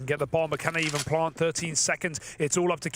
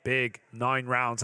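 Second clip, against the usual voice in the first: ordinary talk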